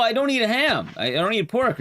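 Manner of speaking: ordinary talk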